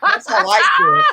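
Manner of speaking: high squealing laugh through the words; laughing hard. Real, not acted. Mocking the show with friends, laughing hard. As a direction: crack up as you say it.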